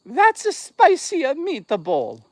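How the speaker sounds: high, slow, hammed-up Italian accent. Shown as a demonstration. Streamer proves he's Italian to viewers, mock Italian accent. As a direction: stretch it out in a cartoonish high-pitched Italian accent. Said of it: "Here's my Italian accent".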